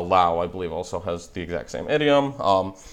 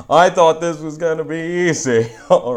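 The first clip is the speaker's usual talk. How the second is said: laughing; 8 semitones higher, 5 dB louder than usual. Real, not acted. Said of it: "I don't know what that is".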